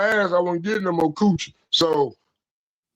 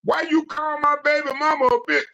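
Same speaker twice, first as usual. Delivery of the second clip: mock indignant question; 7 semitones higher than usual. Retold angry question mid-fight.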